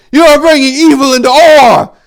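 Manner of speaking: high, loud furious yell; screaming fury; furious accusation. A narrator's reading. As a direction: roar the charge at him. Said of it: "called out in fury".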